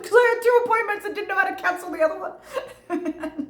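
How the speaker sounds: high, squeaky excuse through laughter; retold sheepish squeal; flustered excuse. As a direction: blurt it high-pitched, half laughing. Acts out flustered excuse to official.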